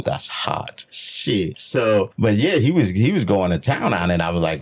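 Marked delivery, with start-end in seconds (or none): 0.0-1.6 hushed aside. Retold or imitated. Aroused murmured comment.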